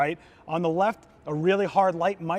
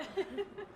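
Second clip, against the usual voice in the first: short high laugh; 14 dB quieter than usual. Real, not acted. Laughs at no sandbag.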